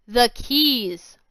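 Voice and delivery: loud angry shout. Acted. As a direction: shout it from the doors. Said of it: "Loxy shouted".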